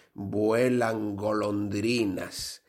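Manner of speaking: drawn-out password; slow, deliberate code phrase. Acted. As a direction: say it slowly like a code. Password said in joke.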